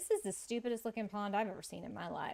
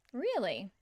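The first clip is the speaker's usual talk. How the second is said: ordinary talk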